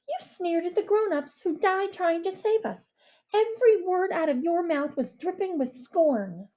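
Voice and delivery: Mia's bitter accusation; scornful girl. A narrator's reading. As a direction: list his sins with contempt. Mia voiced by narrator.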